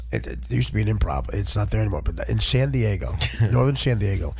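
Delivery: normal talking voice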